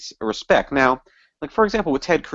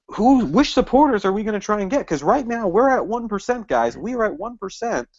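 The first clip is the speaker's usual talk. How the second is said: exasperated rant; 8 semitones higher than usual. Acted. Rant, calling out the party.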